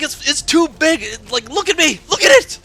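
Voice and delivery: high, loud, frantic protest; imitated exasperation. Girlfriend makes boyfriend look at pad, loud imitated protest. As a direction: mimic a girlfriend at her wits' end.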